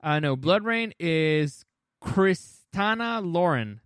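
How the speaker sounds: ordinary delivery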